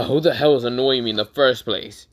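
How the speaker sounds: loud, irritated demand; annoyed groan. Retold or imitated. Woken napper demanding who annoys him, loud annoyed groan. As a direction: come in loud, fed up at being disturbed. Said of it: "said with such an annoyed groan".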